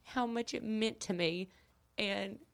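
trying not to cry; holding back tears. Real, not acted. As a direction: voice tight with emotion. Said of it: "Well, I'm trying not to cry".

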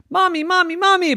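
put-on very high, urgent little-girl call; child calling mommy. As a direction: squeal for your mother. Child calling her mother.

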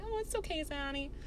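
tiny, high, very soft coo; soothing friend. Retold or imitated. Sweet friend comforting her while rubbing her back, imitated soothing coo.

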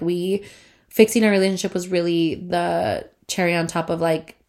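usual speaking voice

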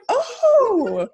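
high, delighted little "oh" of surprise; pleasantly surprised. Retold or imitated. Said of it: "I was like".

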